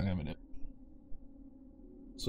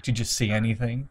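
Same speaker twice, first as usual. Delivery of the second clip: imitating NPC. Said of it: "where he's like".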